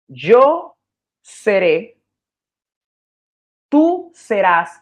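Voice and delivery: "Yo" and "tú" stressed, each syllable drawn out slowly; slow classroom demo of "seré" versus "serás"; slow stressed contrast. Shown as a demonstration. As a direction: spell out "Yo seré, tú serás" slowly, leaning on the pronouns. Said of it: "¿Qué cambió en el verbo ahí".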